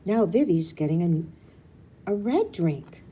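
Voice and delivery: urgent little-sister excitement; excited. Acted. Rue pointing at Vivi's drink, excited.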